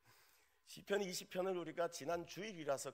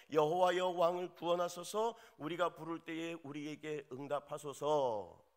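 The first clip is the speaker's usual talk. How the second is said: solemn psalm recitation; 4 semitones higher, 7 dB louder than usual. Acted.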